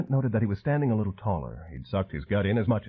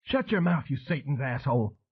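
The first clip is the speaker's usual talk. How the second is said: ranted; 5 semitones higher than usual. A narrator's reading. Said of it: "the woman ranted at him".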